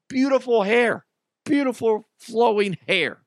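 high, loud swooning admiration; mock gushing. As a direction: gush like a starstruck fan. Gushing over co-host's hair.

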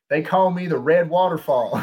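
high, loud Southern drawl; Southern drawl kid. Retold or imitated. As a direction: belt it out like a proud Southern kid with an accent. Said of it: "like, a thick Southern drawl".

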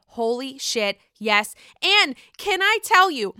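usual speaking voice

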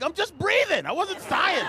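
high-pitched mock indignation; squeaky comic self-defence; defensive protest. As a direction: deny it, voice leaping up. Defensive reply to girlfriend.

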